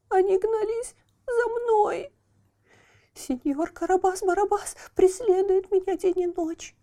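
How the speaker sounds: high, pleading, scared voice; frightened. Acted. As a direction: beg to be hidden, scared. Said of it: "пугливо оглядываясь". (испуганная мольба)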